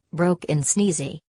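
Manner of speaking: ordinary delivery, nothing marked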